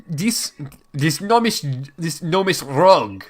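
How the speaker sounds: put-on German accent slipping into French; high-pitched, French-sounding baron; accidental French accent. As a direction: denounce someone in a botched French accent. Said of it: "That's French".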